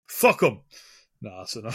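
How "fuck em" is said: mock loud dismissal. Mock outburst about supporting artists.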